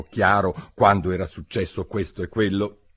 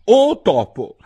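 loud, higher, ceremonious call; formal address; 12 semitones higher, 9 dB louder, slower than usual. A narrator's reading. Alice formally addressing the mouse, loud and high. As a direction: hail the mouse like a grand personage. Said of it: "il modo più appropriato di rivolgersi a un topo".

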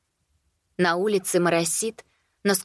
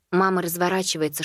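ordinary delivery, nothing marked

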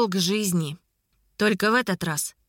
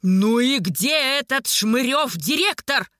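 slow, drawn-out bellow; blustering, stretched demand; angry roar; 3 semitones higher than usual. A narrator's reading. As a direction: roar for the director with theatrical fury. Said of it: "взревел оборотень".

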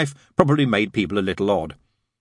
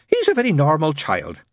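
higher nun voice, falling at the end; reassuring nun; 7 semitones higher, 4 dB louder than usual. A narrator's reading. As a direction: reassure him up high as the nun. Nun assures the father.

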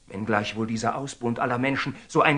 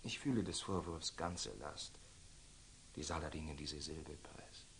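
much lower and much softer, crushed; hushed remorse; 10 semitones lower, 24 dB quieter than usual. Acted. Templar to himself after the Sultan's rebuke, hushed remorse. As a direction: let the weight of the reproach sink you.

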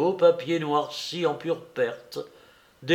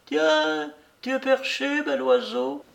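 put-on high, teasing young woman's voice; teasing young woman calling up; young laughing call; 11 semitones higher than usual. A narrator's reading. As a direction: call up to him playfully. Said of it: "une voix jeune et qui riait le héla".

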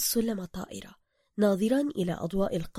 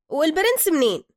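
pushy Cairo driver; 8 semitones higher, 8 dB louder than usual. Acted. Driver character voice.

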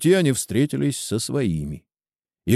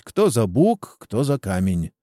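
ordinary delivery, nothing marked

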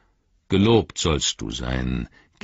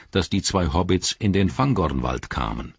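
plain reading voice

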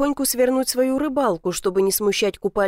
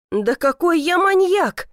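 slow, higher-pitched indignant denial; exasperated outburst; 8 semitones higher, 3 dB louder, slower than usual. A narrator's reading. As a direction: protest as if pushed past patience. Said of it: "не выдержал я". (возмущённо, не выдержав)

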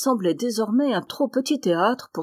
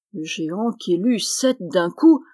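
ordinary delivery

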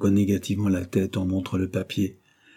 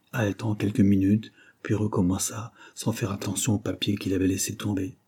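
usual speaking voice

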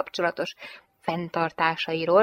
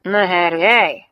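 loud, slow warning; menacing, stretched-out grunt of warning; angry growl; 8 dB louder, slower than usual. A narrator's reading. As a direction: growl it out as a threat. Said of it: "morrant a fickó".

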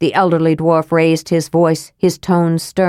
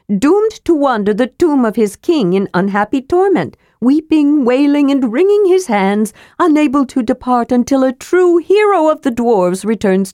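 higher, relishing kender storyteller; kender spinning a tale; 7 semitones higher than usual. Acted. Kender enjoying his tall tale to the dwarves, relishing. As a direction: ham up the ghost story with glee.

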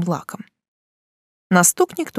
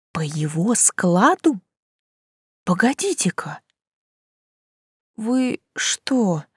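hoarse; slower than usual. A narrator's reading. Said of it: "прохрипела я".

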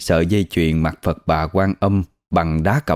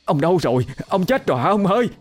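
high frantic cry for her husband, rising higher; panicked wailing; 9 semitones higher, faster than usual. A narrator's reading. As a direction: wail in panic, searching for him. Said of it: "hoảng loạn gào thét lên".

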